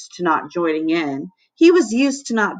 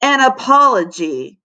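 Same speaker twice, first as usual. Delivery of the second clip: firm demand, pitched a bit higher; a hint of a growling lunch monitor; lightly voiced stern monitor; 5 semitones higher, 4 dB louder than usual. A narrator's reading. Lunch monitor demanding an apology, stern. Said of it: "Missus Bunns would continue".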